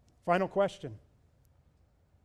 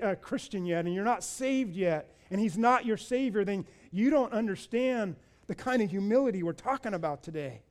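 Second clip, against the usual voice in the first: ordinary delivery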